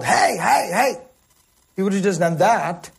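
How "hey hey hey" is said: high, repeated herding calls; mock shepherd's yell. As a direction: imitate a herdsman hailing his animals. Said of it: "shepherd would have just yelled".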